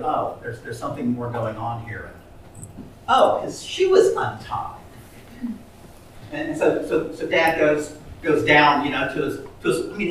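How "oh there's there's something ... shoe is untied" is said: hushed realisation. Acted.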